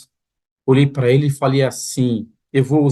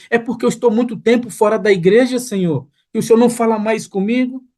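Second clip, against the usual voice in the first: anguished pleading prayer; 7 semitones higher than usual. Retold or imitated. A young man retelling his anguished prayer to the pastor, high pleading voice. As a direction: voice climbing high, begging God for answers.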